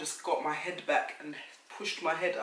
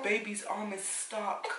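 slow urgent; 6 semitones higher, slower than usual. Retold or imitated. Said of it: "She's like".

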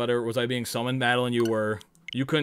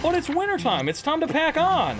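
squeaky silly voice. Pretend. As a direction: say it like a greedy cartoon critter. Playful high voice.

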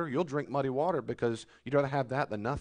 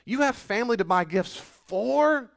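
indignant; 8 semitones higher, 5 dB louder than usual. Acted. Preacher rebukes the complainers.

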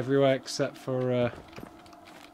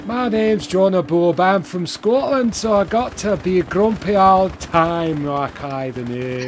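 slow, loud, high put-on Scottish voice; hammed-up grumpy Scottish accent; terrible Scottish accent; 5 semitones higher, 6 dB louder, slower than usual. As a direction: play a grumpy Scot as a wind-up. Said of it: "I do terrible, terrible accents on purpose".

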